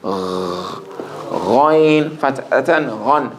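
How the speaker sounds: Ghoin with a gh like clearing the throat, very slow. Shown as a demonstration. Teacher shows how ghoin should scrape the throat. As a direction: drag out the gh from the throat. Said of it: "almost like you're clearing your throat".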